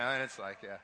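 ordinary delivery

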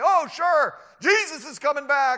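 high, loud sarcastic mockery; scoffing. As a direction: jeer like a gleeful scoffer. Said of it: "oh, they love to scoff".

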